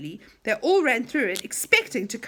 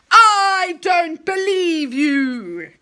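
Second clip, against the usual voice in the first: very slow, loud furious outburst; angry cry; 7 semitones higher, 10 dB louder, much slower than usual. Acted. Enchanter refusing to believe Dave, slow loud anger. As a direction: drag out your disbelief in rage. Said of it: "cried the enchanter".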